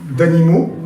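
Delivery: ordinary delivery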